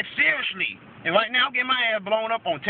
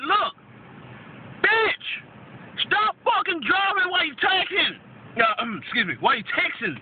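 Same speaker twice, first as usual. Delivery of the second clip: real angry rant, pitched high; totally mad; 5 semitones higher than usual. Real, not acted. Ranting at drivers who text at the wheel, furious. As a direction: let the anger out, high and emphatic. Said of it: "I'm totally mad".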